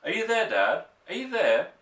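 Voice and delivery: high, loud desperate shout. A narrator's reading. Boy shouting for his father in the night, loud and high. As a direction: shout it into the wood. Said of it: "I shouted".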